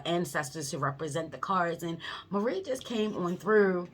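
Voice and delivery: ordinary talk, nothing marked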